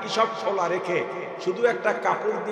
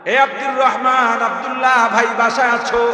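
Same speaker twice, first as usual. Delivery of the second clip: loud, high call from the doorstep; 5 semitones higher, 9 dB louder than usual. Retold or imitated. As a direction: call to the man inside the house. Calling at a neighbour's door.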